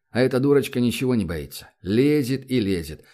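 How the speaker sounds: ordinary delivery, nothing marked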